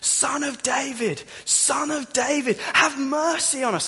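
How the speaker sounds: high, slow pleading cry. Retold or imitated. Blind men by the road calling to Jesus, pleading cry. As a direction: call out for mercy from the roadside. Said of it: "they start crying out".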